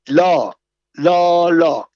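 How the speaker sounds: loud, insistent no, no, no; loud refusal. Acted. The patient refuses the foul medicine, loud and stubborn. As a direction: refuse flatly and loudly.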